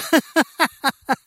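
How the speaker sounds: high, loud cackle; mocking cackle. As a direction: cackle at the joke. Voice laughs after taunt.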